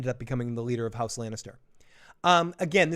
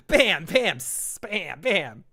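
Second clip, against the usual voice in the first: showy chef "bam"; 4 semitones higher, much slower than usual. Acted. Dragon seasons the burning meat, showy mock bams. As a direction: fire off bams like throwing spice. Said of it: "It was like".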